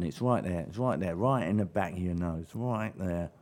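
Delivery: low, soft South East London drone; nasal South East London demo; South East London accent. Shown as a demonstration. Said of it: "the accent in South East London".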